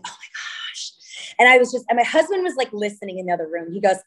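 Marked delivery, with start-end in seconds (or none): 0.0-0.9 breathless whisper of exasperation; whispered oh my gosh. Retold or imitated. After hanging up on a hard sales call, a whispered sigh. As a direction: let it out as a drained whisper.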